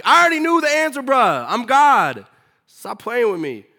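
I'm God, stop playing with me; God losing patience with Cain, loud and high; fed-up God. God scolding Cain in story.

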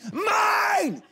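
high, loud furious yell of mine; angry shout. Shown as a demonstration. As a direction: scream Mine like an angry toddler. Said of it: "Now say it with anger".

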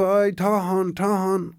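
crowd shouting. Acted. Crowd shouting after thief.

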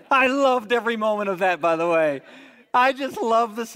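delighted laugh. Real, not acted. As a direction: laugh as you relish it. Laughing over the surprise.

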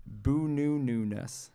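boo-noo-noo-ness said slowly, syllable by syllable; syllables spelled out. Shown as a demonstration. Host sounding out boo-noo-noo-ness for radio listeners. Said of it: "the word boo-noo-noo-ness".

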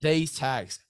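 upbeat sponsor pitch to a mate; hyped ad read. Acted. Host pitching sponsor gear to guest, hyped ad read. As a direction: sell the gear like a mate on air. Sponsor code plug continues.

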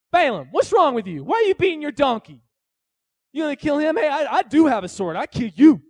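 loud, high scolding tough guy; stern angel, lightly voiced. Angel of the Lord scolding Balaam, loud stern threat. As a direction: scold him like a bouncer with a sword. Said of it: "And the angel says".

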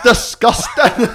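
drawn-out, high mock disgust. Mocking a friend for voting Liberal, slow high disgust. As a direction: stretch it out in fake revulsion.

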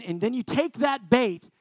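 usual speaking voice

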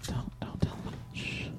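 hushed whisper, barely voiced. Real, not acted. Whispering to a cohost behind the host's back, hushed. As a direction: whisper so the host won't hear.

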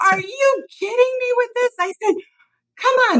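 mother exasperated at her son on the phone; exasperated shout. Retold or imitated. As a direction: yell it at him in disbelief.

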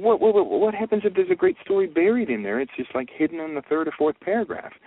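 high, loud stammering disbelief; can't believe what he's hearing; shocked disbelief. Retold or imitated. Shocked question to the gatekeeper.

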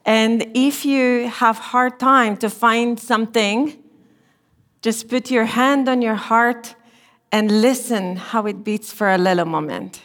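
ordinary talk, nothing marked

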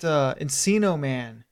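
usual speaking voice

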